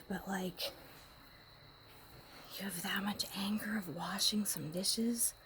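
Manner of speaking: hushed scolding whisper; whispered rebuke. Retold or imitated. Woman whispering what she'd tell her angry partner. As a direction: scold him under your breath. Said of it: "like [name] talking to [name]".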